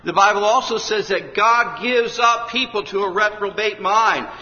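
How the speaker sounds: high, loud fervent preaching; preacher warning sinners that God gives up; preaching at full force. Acted. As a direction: drive the warning home from the pulpit.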